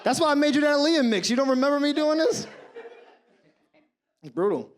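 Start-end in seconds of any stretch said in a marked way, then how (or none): 0.0-2.4 hurt protest. Retold or imitated. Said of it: "I said".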